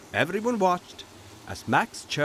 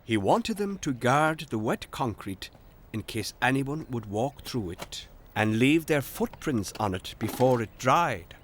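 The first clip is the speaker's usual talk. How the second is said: ordinary delivery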